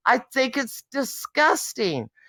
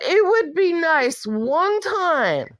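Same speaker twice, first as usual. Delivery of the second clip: normal talking voice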